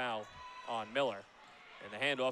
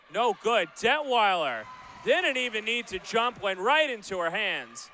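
louder, higher late-game play-by-play; excited call; 6 semitones higher, 7 dB louder than usual. Real, not acted. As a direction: lift the voice for the final seconds.

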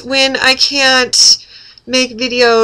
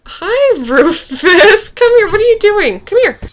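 high, delighted call to a dog; excited pet talk; 10 semitones higher, 4 dB louder than usual. Real, not acted. Vlogger greets the dog Rufus, high and excited. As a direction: coo and call the dog over with a laugh.

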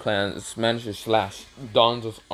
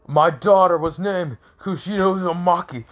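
high, loud angry outburst; enraged father; 8 semitones higher, 5 dB louder than usual. Acted. Kazu confronts Hiruzen about his daughter, furious shout. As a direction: shout it in fury, voice pitched right up.

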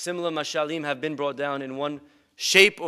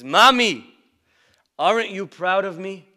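proud son; 4 semitones higher, 6 dB louder than usual. Retold or imitated. Son seeking mother's praise.

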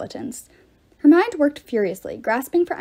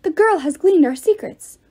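alarmed shout; 7 semitones higher, 6 dB louder than usual. A narrator's reading. Ghosts shouting at once.

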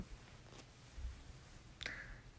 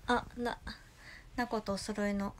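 ordinary talk

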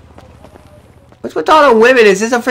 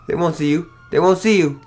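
hushed; 8 semitones lower, 7 dB quieter than usual. Acted. Hushed reassurance while hiding.

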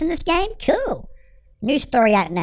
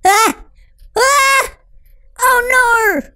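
high, loud startled yells; startled screams, much higher and louder; real scared scream; 12 semitones higher, 6 dB louder, much slower than usual. Real, not acted. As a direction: shriek at the jumpscare. Game jumpscare, real reaction.

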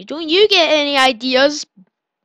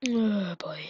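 hushed whispered worry; 5 semitones lower, 10 dB quieter than usual. Real, not acted. Player whispers as danger appears, hushed whisper. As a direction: whisper it, bracing for trouble. Tense moment in game.

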